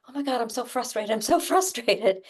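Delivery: frantic frustration. Shown as a demonstration. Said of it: "anxiety is always sort of hyper".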